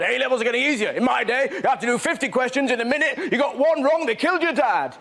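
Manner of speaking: high-pitched, ranting grumbler; mock angry public. Public responding to kids' A-level results, mock angry rant. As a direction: rant like an indignant complainer. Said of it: "everyone's angry, though".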